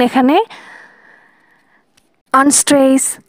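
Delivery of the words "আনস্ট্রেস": ordinary talk, nothing marked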